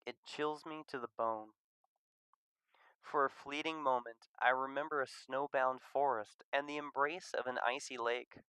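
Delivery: ordinary delivery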